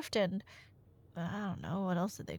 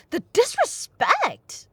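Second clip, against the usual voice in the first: higher, louder, slow hammed-up indignation; mock outrage; 11 semitones higher, 6 dB louder, slower than usual. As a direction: play the wounded diva, drawn out. Mock outrage at listener's remark.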